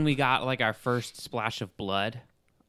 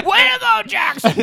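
very high, loud cheer; mock cheering; 9 dB louder than usual. Mocking Jackson thinking Frank celebrates with him, high cheering shout. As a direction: whoop it like a delighted fan.

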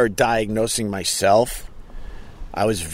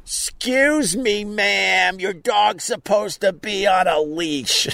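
higher, slow, prissy scold; Karen voice; 10 semitones higher, slower than usual. Retold or imitated. Scolding a beach dog owner about the leash, as Karen. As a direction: play the nitpicking Karen, drawn out. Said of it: "guess who is Karen".

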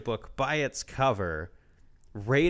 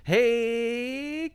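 high call; 10 semitones higher, 3 dB louder than usual. Acted. Calling out to cohost.

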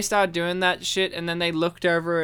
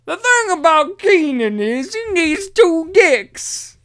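Bill Cosby impression; 10 semitones higher, 7 dB louder than usual. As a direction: mimic a know-it-all old man. Mock Cosby advice voice.

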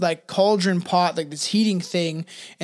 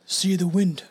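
solemn elder voice. Acted. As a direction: intone it like a wise elder. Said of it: "That was a good one".

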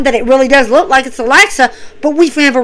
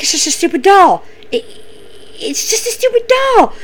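high, shaky mock panic; scared Shayna; 8 semitones higher, 3 dB quieter than usual. Shayna alone in strobing room, high frightened squeak. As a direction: talk yourself down in a frightened squeak. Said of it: "She's like".

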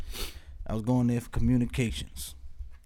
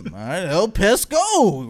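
El Pesco slow, higher, stretched final O; going along emphatically; 4 semitones higher, 5 dB louder, slower than usual. Retold or imitated. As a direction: play along, drawing out El Pesco with force. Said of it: "So I go along with it".

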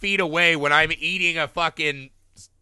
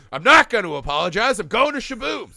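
Kenny Powers; 6 semitones higher, 7 dB louder than usual. Retold or imitated. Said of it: "She's like Kenny Powers".

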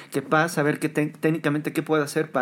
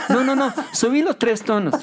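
much higher, laughing as the fussy singer; laughing mock singer; 10 semitones higher, 4 dB louder than usual. Musician retells a singer changing keys, laughing. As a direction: play the singer's flip-flopping with a laugh.

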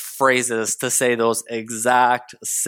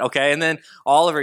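ordinary delivery

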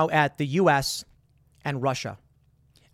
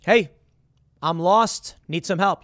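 half-raised calling voice, punched on lost; mock call for help; 3 semitones higher, 5 dB louder, slower than usual. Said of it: "And so you yell out".

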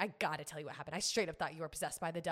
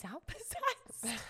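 laughing; 9 semitones higher, 3 dB quieter, much slower than usual. Real, not acted. Laughing at own fear.

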